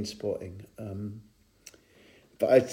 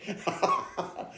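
high breathless laugh; 7 dB quieter than usual. Real, not acted. Guest laughing at his own tale.

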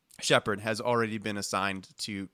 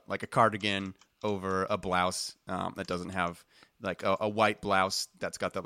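ordinary talk

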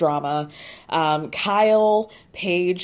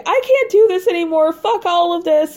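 high, louder mock meltdown yell; retold crying scream; 10 semitones higher, 5 dB louder than usual. Recapping a reality star's tearful outburst, retold crying scream. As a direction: wail it in a mock scream, pitched way up. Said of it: "Amanda starts crying and screaming".

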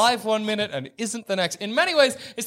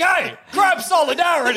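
rallying crab; 9 semitones higher than usual. Acted. Voicing a random crab calling out.

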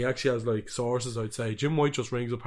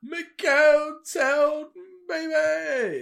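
much higher, slow, drawn-out hype call; 14 semitones higher, 5 dB louder, much slower than usual. Acted. Host cheering the town his co-host went to, drawn-out excited call. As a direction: bellow it like a club MC. Hyping the co-host's hometown night out.

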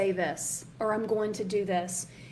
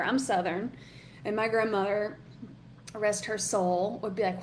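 ordinary talk

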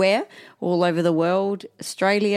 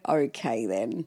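lower, softer mock compliance; mild retold acceptance; 8 semitones lower, 7 dB quieter, slower than usual. Wife retells agreeing to sleep, quiet acceptance. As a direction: give in softly, dropping the pitch. Acts out own resigned reply.

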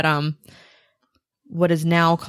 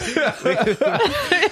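high laughing burst; 9 semitones higher than usual. Real, not acted. Laughs at the vodka brand.

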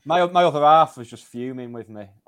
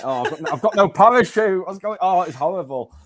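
raised, laughing mock panic; 7 semitones higher, 6 dB louder than usual. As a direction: re-enact comic alarm, high and loud. Acting out falling without parachute.

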